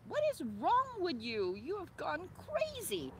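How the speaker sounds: voicing an outraged lover yelling back; high, shouted retort; Otis yelling back. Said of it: "Otis yells back".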